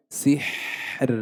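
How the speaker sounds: سحر said slowly, with a thin, light final ر; teacher's drawn-out model of سحر, ending in an unvowelled light ر. Shown as a demonstration. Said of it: "بالترقيق هكذا".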